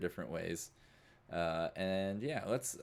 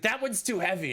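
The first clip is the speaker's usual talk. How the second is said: high, loud complaint; urgent shout; 12 semitones higher, 5 dB louder than usual. Acted. Eddie points Jupiter to the heavy rack, shouting urgently. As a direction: shout it while wrecking the place.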